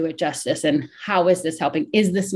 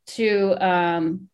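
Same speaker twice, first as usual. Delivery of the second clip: ordinary talk, nothing marked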